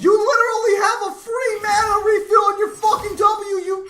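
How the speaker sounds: high, loud cracking yell; raging, voice cracking high; furious shout. Real, not acted. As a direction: scream it out in frustration. Raging at teammate.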